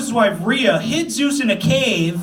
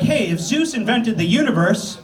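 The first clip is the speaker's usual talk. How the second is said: put-on higher, slowing kid question; nephew voice; 7 semitones higher than usual. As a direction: ask it like a curious nephew. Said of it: "He's like".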